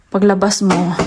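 ordinary talk

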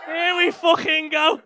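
loud, high, drawn-out holler; here-comes-trouble yell; rousing shout. Real, not acted. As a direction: shout it slowly, stretching every word. Bracing for trouble in crowd.

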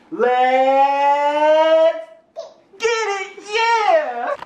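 high loud hyped-up chant; hype shout. Real, not acted. Dad hyping up his baby with their catchphrase, high excited shout.

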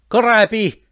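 high-pitched cry; shouted. A narrator's reading. Abandoned passenger shouting after the departing professor. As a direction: call after someone already gone. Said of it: "gridai guardando la traccia".

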